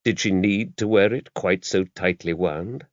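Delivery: ordinary delivery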